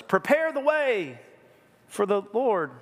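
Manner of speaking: a hint of an angelic herald; lightly angelic voice. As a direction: announce it like an angel, drawn out.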